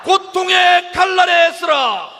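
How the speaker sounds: slow, high, shouted slogan; roaring rallying speech. Real, not acted. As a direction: bellow the chant for the crowd to echo. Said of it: "우리의 구호를 함께하겠습니다".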